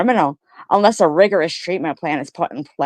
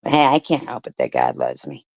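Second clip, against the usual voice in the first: smug; 14 semitones lower than usual. Real, not acted. Smug taunt to haters.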